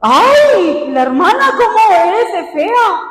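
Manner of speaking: high, drawn-out mock dismay; mock exclamation. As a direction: exclaim it high, stretching the words. Mimics someone criticising her.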